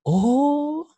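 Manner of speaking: long, very high-pitched "Oh"; mock-interested husband, voice shooting up; attentive husband's response. Shown as a demonstration. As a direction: draw out an exaggerated listening "Oh". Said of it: "Yang kata pertama adalah".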